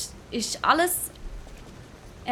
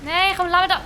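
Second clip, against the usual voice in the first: loud, high, pleading with the screen; urgent shout; 8 semitones higher, 6 dB louder than usual. Real, not acted. Streamer yelling at his game character to run, urgent. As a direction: yell at the character to run there.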